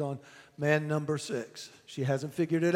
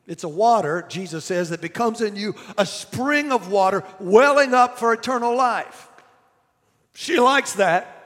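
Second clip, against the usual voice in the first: raised, driving sermon voice; preaching; 4 semitones higher, 7 dB louder than usual. Acted. Preacher retelling the well scene to his church, loud and driving. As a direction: preach it to the whole congregation.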